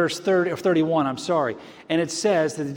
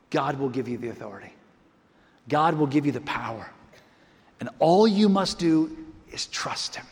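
hushed promise; 3 semitones lower than usual. Acted. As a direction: reassure the struggling listener tenderly. Sudden hushed promise to congregation.

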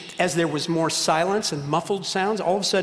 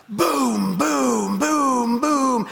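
drawn-out booms, raised in pitch; imitated fireworks; 7 semitones higher, 3 dB louder, much slower than usual. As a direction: boom it out like bursting fireworks.

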